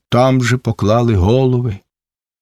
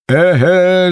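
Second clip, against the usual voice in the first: raised triumphant exclamation; shouted aha; 6 semitones higher than usual. A narrator's reading. Ataman recognizes Cherevan, shouting. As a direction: spot your target and shout. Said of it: "закричав отаман".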